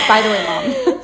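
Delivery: put-on high, loud child voice, laughing; a podcast host laughing as she imitates the daughter; mock little girl. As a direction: play the offhand kid dropping a bombshell. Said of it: "She's like".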